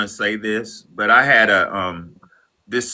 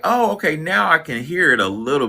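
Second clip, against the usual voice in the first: retold realization; 8 semitones higher than usual. Retold realization about accent.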